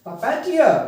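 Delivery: Puppeteer with tier high and loud; stress on last syllable. Shown as a demonstration. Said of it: "stress देंगे". (Puppeteer में tier पर ज़ोर)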